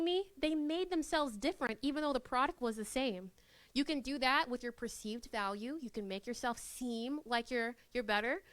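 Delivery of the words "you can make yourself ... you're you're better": ordinary talk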